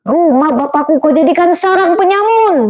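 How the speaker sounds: imitating an angry rebuke, high and rising; angry Jesus quote. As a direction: re-enact a film's outraged cry, voice climbing. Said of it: "saya lihat dia bagian".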